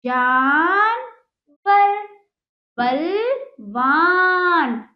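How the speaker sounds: drawn-out words, each one set apart; slow clear dictation. Acted. Teacher to pupils, slowly modelling the long-a words. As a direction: stretch each word so children catch it. Slow word list for children.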